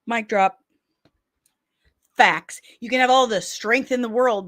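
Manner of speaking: ordinary talk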